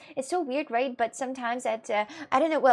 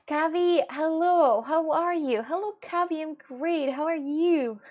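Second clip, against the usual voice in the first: high, loud, delighted hello; gushing, lilting welcome; singsong greeting; 6 semitones higher, 5 dB louder, slower than usual. Real, not acted. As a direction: greet a favourite friend in an excited singsong. Greets arriving viewer in singsong voice.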